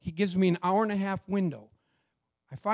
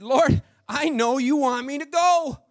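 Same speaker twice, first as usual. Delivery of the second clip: higher, louder, overcome with emotion; tearful cry to God; 6 semitones higher, 6 dB louder than usual. Real, not acted. Driver praying aloud after the gift, overcome and loud. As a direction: cry it out, overwhelmed. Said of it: "I just started weeping".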